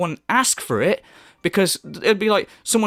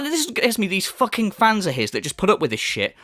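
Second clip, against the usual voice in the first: ordinary talk, nothing marked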